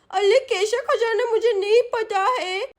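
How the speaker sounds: put-on high, childish lisping voice; protesting little girl. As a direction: plead and scold like a small girl held captive. Caught girl denies knowing anything.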